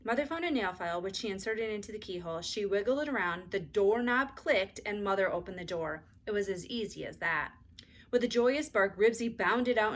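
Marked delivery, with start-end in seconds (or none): none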